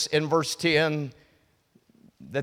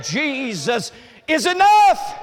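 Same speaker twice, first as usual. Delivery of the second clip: slow loud shout, much higher; shouted proclamation; 11 semitones higher, 7 dB louder, slower than usual. Acted. Preacher to congregation, shouting the key line. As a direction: proclaim it at full voice. Shouted then repeated softly.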